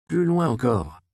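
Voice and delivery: mildly insistent instruction; firm, insistent urging. A narrator's reading. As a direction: press them to move back. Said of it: "insista Andreas".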